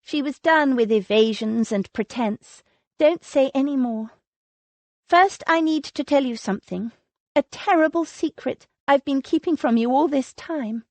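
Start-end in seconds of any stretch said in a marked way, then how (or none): 3.0-10.8 put-on higher, urgent young woman. A narrator's reading. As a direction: press on before losing nerve. Lily confesses a secret.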